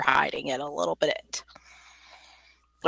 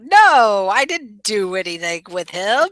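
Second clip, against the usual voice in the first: mock outright denial; 4 dB louder than usual. Player imitates what her character isn't saying, mock denial. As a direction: swoop up on the No, hammed-up. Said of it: "she's not saying outright, you know".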